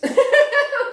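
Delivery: loud, high laugh; sudden high laughing fit; burst of laughter. Real, not acted. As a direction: crack up at your own remark. Amused by the tomato connection.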